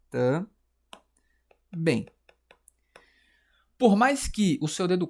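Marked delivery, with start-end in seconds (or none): 0.1-2.1 "Também" in two detached syllables; long gap between "Tam" and "bém" in "Também". Shown as a demonstration. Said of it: "esqueci de separar". ("Também" separado em sílabas, com pausa)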